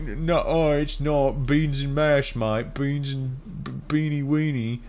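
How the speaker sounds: high, indignant cartoon Brit; mock British accent. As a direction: protest in an exaggerated British accent. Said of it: "And they're like".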